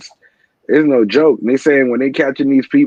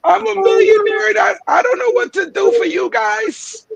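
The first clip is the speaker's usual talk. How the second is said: much higher, hammed-up fake crying wail; over-the-top sobbing falsetto parody; mock crocodile tears. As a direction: whine it like a rich man faking sobs. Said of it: "You see the crocodile tears?".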